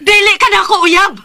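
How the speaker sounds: high, loud angry breakup line; furious shout. Acted. Sarah screaming at her boyfriend that he's no longer hers. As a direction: scream it at him.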